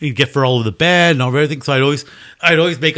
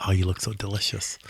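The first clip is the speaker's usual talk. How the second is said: cat drooling; 6 semitones lower, 10 dB quieter than usual. Retold or imitated. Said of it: "They're like".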